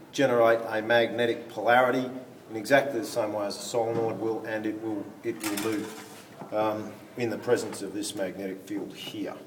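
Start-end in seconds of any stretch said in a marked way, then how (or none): none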